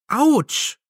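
sharp high yelp of pain; startled ouch; sudden pain. Acted. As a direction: cry out at a sudden sting. Sudden sting in hand.

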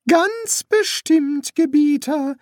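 very high, slow priest voice. Acted. Priest reassuring his young master, high and slow. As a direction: draw it out in a high put-on voice.

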